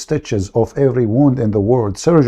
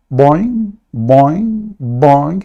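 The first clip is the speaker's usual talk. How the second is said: boing sound effect; much slower than usual. Retold or imitated. Kangaroo jumping imitated.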